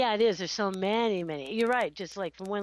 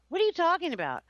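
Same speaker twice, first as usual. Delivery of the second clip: higher-pitched, mock-baffled question; baffled retort; 7 semitones higher, 3 dB louder than usual. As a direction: retell your own bewildered reaction. Retelling her baffled reaction.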